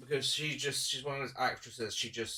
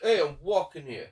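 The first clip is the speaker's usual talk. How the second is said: loud, raised New Yorker caricature; 4 semitones higher, 9 dB louder than usual. Acted. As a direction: do a brash mock New Yorker. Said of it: "he's playing a bit of a stereotype".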